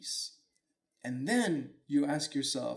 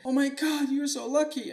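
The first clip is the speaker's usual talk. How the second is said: very high, loud mock gushing; excited neighbors; 11 semitones higher, 6 dB louder than usual. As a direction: squeal it out like thrilled neighbors. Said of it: "all the neighbors are like".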